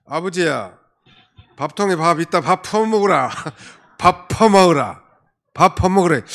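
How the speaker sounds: put-on little girl loudly telling her father to eat, in Gyeongsang accent; little girl in Gyeongsang dialect. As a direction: call out to dad like a blunt little Gyeongsang girl. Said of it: "자기 아버지한테".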